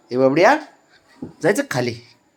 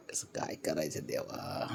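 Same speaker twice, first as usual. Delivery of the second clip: whisper; 8 semitones lower, 20 dB quieter than usual. Real, not acted. Exasperated aside.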